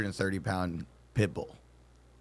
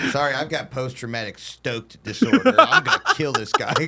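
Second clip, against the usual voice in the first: rising, higher and louder through laughter; laughing mock veteran; 4 semitones higher, 5 dB louder than usual. Mock veteran facing a burglar, laughing. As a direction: gleefully threaten while cracking up. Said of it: "mock veteran voice".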